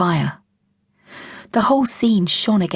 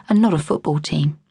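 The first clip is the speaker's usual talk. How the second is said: ordinary delivery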